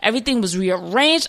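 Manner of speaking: ordinary delivery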